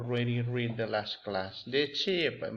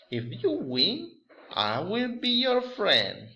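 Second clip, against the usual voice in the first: higher, slow eager dare; lightly excited horse challenge; 8 semitones higher, 4 dB louder, slower than usual. Acted. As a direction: dare the dog to race. Horse sets a race challenge.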